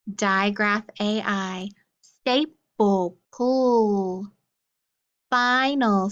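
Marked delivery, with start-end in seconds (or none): 2.0-4.3 "staple" then its ending "pull" alone, drawn out; sound card drill. Shown as a demonstration. Teacher drilling sound cards with the class, ending isolated. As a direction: say "staple", then hold the last syllable "pull". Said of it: "get ready for our sound cards".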